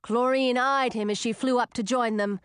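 plain reading voice

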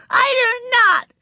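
put-on very high, loud little-girl shout. A narrator's reading. Ramona shouting denial at her sister, high and loud. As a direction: yell it back like an angry small child. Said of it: "shouted Ramona".

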